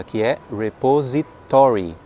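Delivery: repository with the stress on "to": re-po-SI-to-ry; repository stressed on "to", slow and clear; correct stress. Shown as a demonstration. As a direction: lean on the "to" of repository. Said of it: "A sílaba tônica cai no to".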